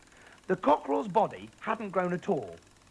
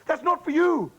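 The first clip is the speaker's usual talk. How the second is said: high, loud frantic plea; higher, louder urgent pleading; alarmed pleading; 12 semitones higher, 8 dB louder than usual. Acted. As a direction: stop mother in a panic. Daughter frantically stops Grandma drinking.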